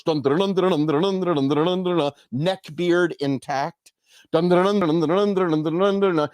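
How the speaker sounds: rhythmic galloping chant of dun. Retold or imitated. Mimicking Don Quixote's horse galloping, rhythmic dun dun chant.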